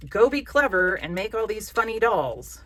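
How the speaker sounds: slow, loud, hammed-up order; slow mock pep command. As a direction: hand down an absurd order, drawn out. Voices an unreasonable order to oneself.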